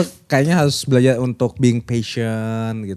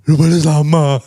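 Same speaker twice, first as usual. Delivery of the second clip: lowest tone; 6 dB louder than usual. Shown as a demonstration. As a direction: put on your lowest register, firmly. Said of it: "tone paling bawah".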